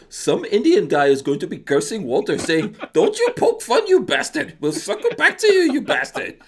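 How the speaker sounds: put-on high-pitched Indian accent, rising on the curses. Viewer's message cursing Walter, read in an Indian accent. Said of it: "do this in my Indian accent".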